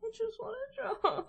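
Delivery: high, laughing whine; real despairing laugh. Real, not acted. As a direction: laugh at your own frustration. Frustrated at software.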